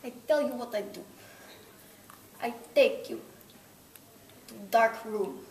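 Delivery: lightly put-on menacing Russian accent; a hint of a Russian villain threatening. Russian accent, threatening the interviewer who asked. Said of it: "tell me how you would kill me in, with a Russian accent".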